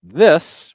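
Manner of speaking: This said high and loud; emphasized this. Shown as a demonstration. Coach repeating the stressed word This. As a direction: punch the word This. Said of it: "we could emphasize just certain words".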